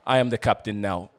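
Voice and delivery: put-on commanding pirate captain in an African accent. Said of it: "I'm gonna do an African accent".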